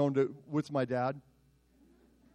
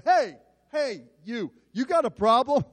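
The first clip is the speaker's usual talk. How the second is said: higher, louder confrontation; loud challenge; 6 semitones higher, 5 dB louder than usual. Retold or imitated. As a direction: square up and call him out. Pastor confronts the college boy.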